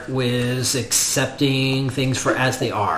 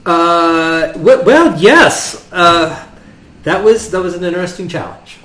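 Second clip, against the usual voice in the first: flustered stammer; 6 semitones higher, 8 dB louder than usual. Acted. Player flustered in character.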